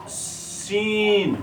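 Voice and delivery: सीन with the long ई held out very slowly; stretched model word. Shown as a demonstration. Teacher to children, stretching the long vowel in सीन. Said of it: "जैसे कि हम यहाँ लिखेंगे".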